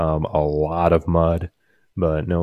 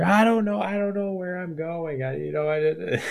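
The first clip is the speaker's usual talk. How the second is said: high, softer imitation of grandma in the background; drowned-out voice; 11 semitones higher, 4 dB quieter than usual. Grandma on phone drowned out by beeping, faint mock fluster. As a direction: mimic a flustered voice lost behind beeping. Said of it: "is drowning her out".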